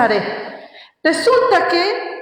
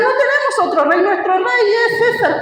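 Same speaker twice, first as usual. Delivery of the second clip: mock crowd reply; 7 semitones higher than usual. Priests answer Pilate, retold.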